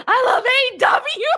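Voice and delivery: high, loud euphoric mock yell; imitated fan yelling. That guy in his euphoric moment, imitated yell. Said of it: "that guy yelling".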